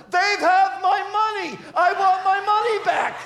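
high, loud outraged yell; imitating a grandchild's shouted protest; child's scream. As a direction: scream it out like a kid robbed of his cash. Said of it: "he screams out".

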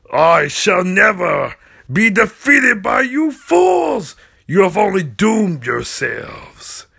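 slow, loud evil proclamation; booming villain threat. Acted. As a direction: declare doom on them.

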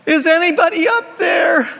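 high, loud panicked yell upward; panicked shout. Acted. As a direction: scream it as the root snaps. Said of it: "feels one of the roots of the branch go snap".

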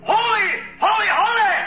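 high ringing shout of holy; hollered praise. Acted. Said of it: "When he hollered".

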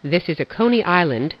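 ordinary delivery

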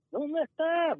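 high, slow demanding question; impatient boss calling. Retold or imitated. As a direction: stretch it out like a nagging boss. Boss calling every morning. (jefe impaciente, agudo y lento)

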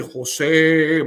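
loud, drawn-out call of the name; imitated stronger voice. The voice calls the husband a second time, imitated loud powerful call. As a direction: boom the name out, imitating a mighty voice. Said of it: "más fuerte esa voz".

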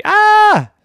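sudden high loud yelp; a scared little shriek as he slips; startled cry. Acted. Boy almost falls from tree, yelping.

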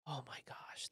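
a breathless hushed whisper; relieved whisper. Real, not acted. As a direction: whisper it like a wish.

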